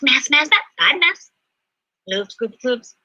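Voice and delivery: ordinary delivery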